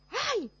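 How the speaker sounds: sharp high "ay" of sudden pain; acted cry of pain. Teacher acting out being stepped on, a sharp high yelp. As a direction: yelp like someone just stepped on your foot.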